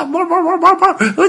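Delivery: very high, loud frantic yelps; terrified dog howling. A narrator's reading. Little dog trapped by the lion, panicked high yelping. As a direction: yelp wildly at the top of your range. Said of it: "吓得大叫".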